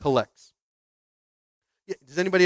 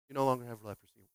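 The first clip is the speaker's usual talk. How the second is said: Jedi master; 4 semitones lower, 10 dB quieter, faster than usual. Pretend. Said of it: "like a Jedi master".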